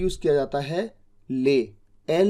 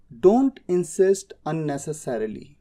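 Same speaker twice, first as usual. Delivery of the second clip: slow model sentence; much slower than usual. Shown as a demonstration. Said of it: "उसी तरह से बोलिए".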